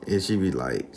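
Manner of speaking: ordinary talk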